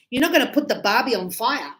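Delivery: normal talking voice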